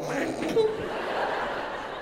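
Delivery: high squealing suppressed giggle; wife's sudden giggle. Retold or imitated. Husband imitating wife during a movie, sudden high giggle. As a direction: burst into an imitated squeaky laugh. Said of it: "all of a sudden she'll go".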